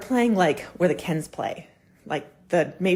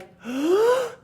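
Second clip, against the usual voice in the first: gasp; 4 dB louder than usual. Retold or imitated. Daughter gasps at the news.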